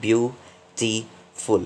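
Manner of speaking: beautiful stretched very slow, each syllable drawn out; a teacher sounding out beautiful for the class; slowly syllabified. Shown as a demonstration. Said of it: "इसके more than one syllable हैं".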